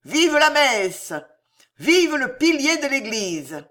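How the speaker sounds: high, loud, drawn-out cheer; fervent yelled acclamation; barked crowd cries. A narrator's reading. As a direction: shout it like a rallying slogan from a savage mob. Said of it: "parmi ces abois distinguèrent".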